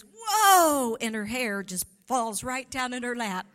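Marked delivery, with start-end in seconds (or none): 0.0-1.0 loud high whoa; imitating mother's whoa. Daughter imitates mom's startled prayer shout, loud whoa. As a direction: burst out with a big whoa. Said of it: "And she goes".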